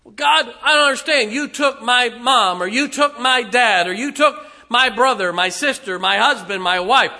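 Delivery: loud, high accusing lament; mock grief-stricken complaint to God; grieving believer. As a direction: list each loss as a hurt charge. Preacher voices a grieving believer.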